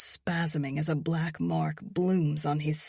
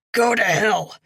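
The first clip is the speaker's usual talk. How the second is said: loud angry bark; 5 semitones higher, 11 dB louder than usual. A narrator's reading. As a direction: bark it out defiantly. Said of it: "he barks".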